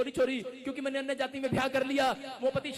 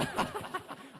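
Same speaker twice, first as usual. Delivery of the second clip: breathy laugh; 8 semitones higher, 6 dB quieter than usual. Real, not acted. Laughing at the story.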